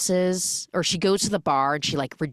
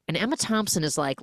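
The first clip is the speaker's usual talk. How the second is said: ordinary delivery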